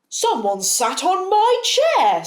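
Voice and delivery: Big Bear complains his chair was used. Acted. Big Bear, indignant and loud.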